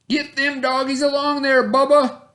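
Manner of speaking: high, loud barking order; bossy stepbrother. A narrator's reading. Stepbrother ordering Bubba about, loud and high. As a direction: boss him around from horseback. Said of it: "ordered Dwayne".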